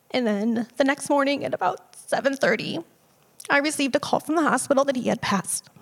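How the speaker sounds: real choked-up grief, pitched high; voice breaking. Real, not acted. Daughter tells of the hospital call, voice breaking with grief. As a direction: fight through tears to get the words out. Recounts her father's death, choked up.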